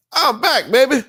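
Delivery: high, loud cocky robot voice; brash robot catchphrase; Bender impression. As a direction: swagger like the robot.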